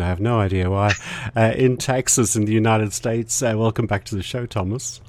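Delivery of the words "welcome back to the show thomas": normal talking voice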